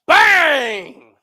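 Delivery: loud high shout; yelling from the sidelines. Real, not acted. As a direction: shout the finish. Shouting the finish.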